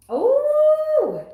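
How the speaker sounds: a sudden high loud oh; startled at the card; surprised oh. Real, not acted. Reader startled by a card jumping out, high and loud.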